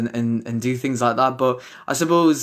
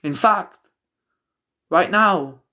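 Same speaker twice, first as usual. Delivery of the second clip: higher-pitched, measured spoken-word delivery with pauses between phrases; poem recital; 7 semitones higher than usual. Acted. Poet performing a post-lockdown poem to online subscribers, measured poem recital.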